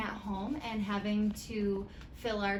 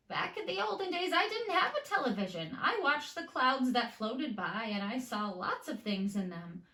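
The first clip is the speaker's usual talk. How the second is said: higher, quicker grandma voice; grandma remembering; 3 semitones higher than usual. Acted. Grandma telling Molly she watched clouds instead of TV, higher.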